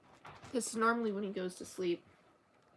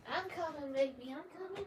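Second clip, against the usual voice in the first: cooing to pet; 7 semitones higher, 5 dB quieter than usual. Real, not acted. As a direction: reassure the cat softly, voice lifted. Answering her meowing cat.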